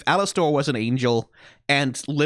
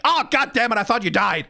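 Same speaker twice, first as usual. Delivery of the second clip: exasperated Husk; 8 semitones higher than usual. Retold or imitated. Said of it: "Husk is like".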